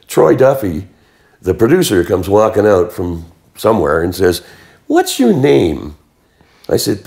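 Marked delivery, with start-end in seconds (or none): 4.9-6.0 high, slow, drawn-out challenge; retold demand. Producer walks out and confronts an extra, slow high-pitched demand. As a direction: imitate a producer demanding an answer, stretching the words.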